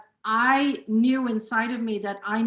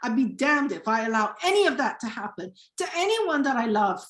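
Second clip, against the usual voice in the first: normal talking voice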